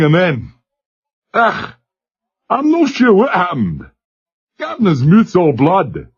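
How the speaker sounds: put-on high Glasgow accent, confused; a stunned young Scot fumbling; dazed Glasgow lad. A narrator's reading.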